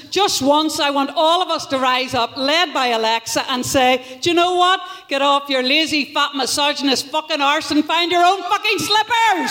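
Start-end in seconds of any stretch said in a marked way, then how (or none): 4.2-9.5 acted fury building to a shout; mock-furious tirade with a shouted finish; rebellious outburst. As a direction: tell him off, rising higher into a yell. Acts Alexa revolting against lazy men.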